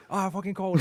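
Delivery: angry shouting. Retold or imitated. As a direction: snap it out furious. Dad angry on the phone.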